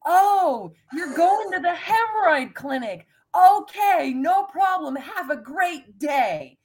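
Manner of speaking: high, loud, friendly call across a parking lot; nice cheery yell. Retold or imitated. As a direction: shout it sweetly, slowing on the goodbye. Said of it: "And I yell out".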